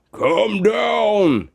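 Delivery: called up into the tree; bear calling up. Acted. Bear at the tree's foot calling up to Josephine. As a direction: call her down. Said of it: "he called".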